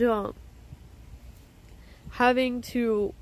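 ordinary delivery

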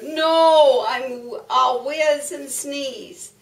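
loud, high cow wail, drawn out; protesting cow. Acted. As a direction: moo out a big refusal. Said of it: "The cow moved".

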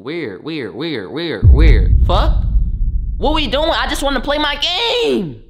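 builds from muttering into a high, loud wail; rising exasperated yell that ends in a whine; frustrated outburst. Retold or imitated. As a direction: blow up, fed up with everyone. Fed up at weird house.

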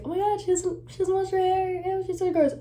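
high, mocking gossip voice; mock judgmental viewer. Imitating viewers who judge her hair habits, high and mocking. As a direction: sneer it like a catty girl. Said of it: "People are gonna be like".

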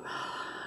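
long tired unvoiced sigh; weary sigh. Real, not acted. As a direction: let out a heavy sigh. Frustrated about nail biting.

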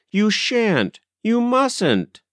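high, urgent forbidding; frantic. A narrator's reading. Governess barring mother from the house, frantic. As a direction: forbid it wildly, pitch climbing.

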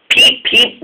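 high breathy whistle peeps; whistling peep. A narrator's reading. Neville whistling to the Fat Controller, high whistle. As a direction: whistle it like a little engine. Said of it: "whistled Neville".

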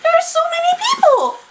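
high, fast thrilled squeal; excited squeal. Real, not acted. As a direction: squeal with delight at the crowd. Excited reaction to game trailer.

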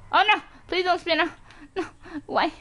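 high-pitched cry of alarm; panicked plea. Real, not acted. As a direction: plead in a high panic as control slips. About to spin out.

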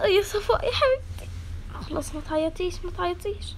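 gentle comfort. Acted. Comforting a crying classmate.

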